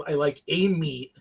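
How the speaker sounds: "a" said slowly as a long AY; stress on A. Real, not acted. Pizza fan clarifying his order to a friend, stressing "a". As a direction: lean on "a", drawn out as AY. Clarifying he meant just one meat.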